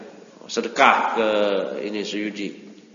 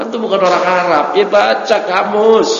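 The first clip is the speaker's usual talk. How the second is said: a teacher telling students off, loud and half-laughing; loud scolding; 8 semitones higher, 8 dB louder than usual. Real, not acted.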